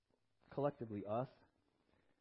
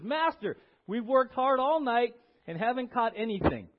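louder, higher irritable protest; irritable Peter; 4 semitones higher, 8 dB louder than usual. Acted. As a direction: complain like a sleepless fisherman to his boss. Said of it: "Maybe a little bit irritable".

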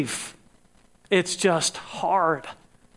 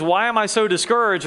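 usual speaking voice

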